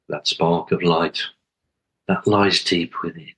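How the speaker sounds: calm, spacious meditation leading; guided meditation. Acted. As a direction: guide listeners gently inward, phrase by unhurried phrase.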